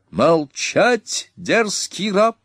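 high, slow commanding yell; a young prince screaming at a slave; furious shout. A narrator's reading. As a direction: bark the order from high up. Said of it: "закричал Эрнак".

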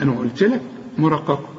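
normal talking voice

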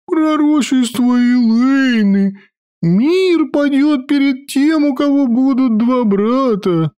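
mock sepulchral voice, slow; hammed-up spooky prophecy voice; oracle. A narrator's reading. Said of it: "дурашливо загробным голосом".

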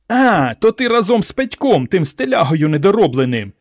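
high, scornful outburst; indignant maternal yell; mother shouting. A narrator's reading. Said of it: "кричала мати".

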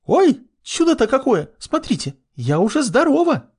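put-on high squealing girl voice; giddy party girl. A narrator's reading. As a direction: squeal with sudden joy. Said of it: "Взвизгнула девчонка".

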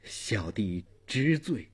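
soft, slow, cowed admission; timid. A narrator's reading. Bei Wang admits guilt to Dong Wang, timidly. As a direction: shrink into a near whisper, meekly. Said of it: "北王胆怯地说".

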